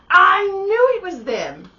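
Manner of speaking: high, loud, slow I knew it; triumphant. Retold or imitated. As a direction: crow over being right, slowly. Triumphant realization.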